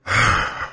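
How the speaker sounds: low, soft real sigh; exasperated sigh. Real, not acted. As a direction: let out a weary breath after ranting. Exasperated pause after rant.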